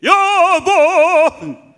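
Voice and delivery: loud, high, hugely drawn-out holler; husband's full-voiced call. Husband freely calling his wife, long booming holler. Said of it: "남편으로 하여금 소리내게".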